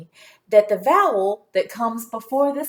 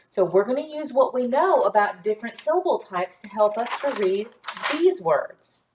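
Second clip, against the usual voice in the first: ordinary delivery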